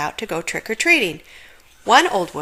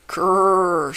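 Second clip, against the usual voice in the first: dog growl; 3 dB louder than usual. Acted. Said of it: "said Martha".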